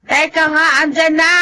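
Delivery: high, impatient call. Acted. Disturbed sleeper calling to the door, high and impatient. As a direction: shout toward the door, annoyed.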